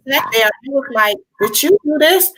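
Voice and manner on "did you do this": high, loud shocked question. Retold or imitated. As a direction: ask it in disbelief. Shocked question about phone.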